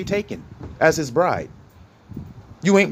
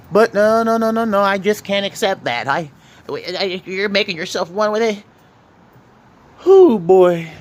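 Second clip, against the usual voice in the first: hammed-up objector shaking it off, louder; lightly voiced doubter pushing back; mock refusal; 3 semitones higher, 3 dB louder than usual. Mimics a resisting listener.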